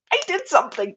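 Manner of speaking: small high-pitched proud squeal; mock thrilled. Breeder reacting to mentor's compliment, thrilled squeak. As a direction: squeak it out, overcome with pride.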